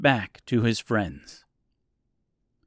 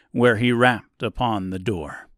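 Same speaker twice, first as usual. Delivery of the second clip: usual speaking voice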